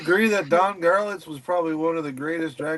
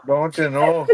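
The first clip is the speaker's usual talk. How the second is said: put-on Minnesota accent; 4 dB louder, slower than usual. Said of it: "hitting you with the don't you knows".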